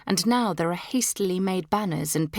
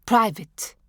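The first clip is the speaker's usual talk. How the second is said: smartly; 5 semitones higher, 4 dB louder than usual. A narrator's reading. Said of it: "my mother interrupts smartly".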